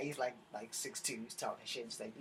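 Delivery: ordinary delivery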